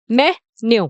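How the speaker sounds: "मेन्यू" split into me, nyo; correct pronunciation. Shown as a demonstration. Teacher drilling the answer for learners, "मेन्यू" in two separate beats. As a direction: say "मेन्यू" as open me, falling nyo. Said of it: "क्या बोलते हैं".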